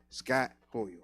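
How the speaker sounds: ordinary talk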